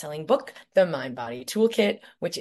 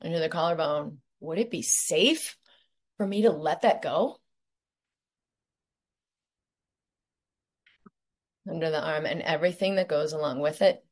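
guided meditation. Acted. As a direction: lead them gently through each point.

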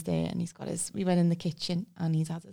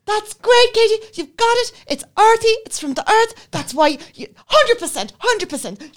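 much higher, much louder gushing praise; 14 semitones higher, 11 dB louder than usual. Retold or imitated. Wine teacher praising Katie's answer, high loud gushing. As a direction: gush like a wine teacher thrilled with a pupil. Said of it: "And he's like".

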